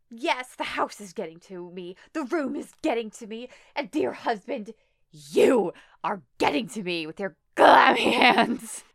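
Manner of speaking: mock hysterics building to a loud peak; unhinged wife. Wife turns on dear husband, building melodramatic rant. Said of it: "she's like".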